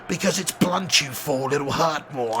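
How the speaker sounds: lightly voiced sneer at a fool; Alan Rickman as the Sheriff. Streamer quoting the Sheriff's reply, quiet and contemptuous. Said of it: "Alan Rickman, legend".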